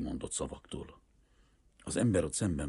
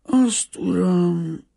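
high, loud, emphatic answer; earnest peasant father; 13 dB louder, much slower than usual. A narrator's reading. Greek father looking the banker in the eye, earnest and emphatic. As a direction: answer squarely, voice raised.

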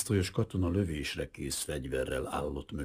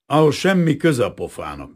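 loud grumble; 8 semitones higher, 10 dB louder than usual. A narrator's reading.

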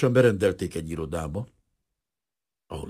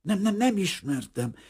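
higher, stubborn repeated no; stubborn denial; 13 semitones higher than usual. A narrator's reading. Refugee stubbornly denying to the stranger. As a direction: deny it doggedly, teeth chattering. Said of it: "hajtogatta Vrana Bazil makacsul".

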